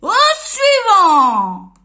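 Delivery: very slow, very loud bellow; drawn-out booming call; grocer shouting. Acted. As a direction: call the next customer like a foghorn. Grocer dismisses customer.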